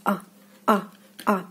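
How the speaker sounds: "ah" as a short open vowel; reduced Russian O sounding "ah"; unstressed O as ah. Shown as a demonstration. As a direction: say each "ah" briefly and separately. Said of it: "the letter O is unstressed".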